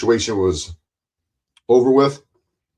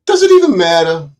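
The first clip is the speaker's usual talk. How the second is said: higher and louder, pressing the question; louder, higher second asking; louder repeat; 7 semitones higher, 5 dB louder than usual. Real, not acted. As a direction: repeat it with more force and a raised pitch.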